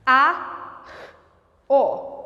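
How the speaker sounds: K-A-O split into separate sounds; one phoneme at a time. Shown as a demonstration. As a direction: say each sound of carro apart. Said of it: "em câmera lenta".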